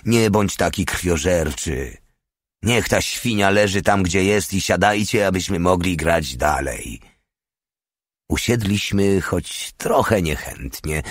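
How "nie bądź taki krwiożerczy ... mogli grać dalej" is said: ordinary delivery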